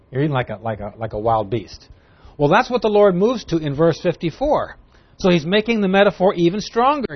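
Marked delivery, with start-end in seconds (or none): none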